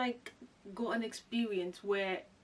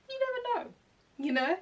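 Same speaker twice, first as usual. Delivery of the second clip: much higher, a bit louder, hopeful lilt; high sing-song you-never-know; 12 semitones higher, 5 dB louder than usual. Acted. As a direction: lift it up high with hope. Hopeful about a job call.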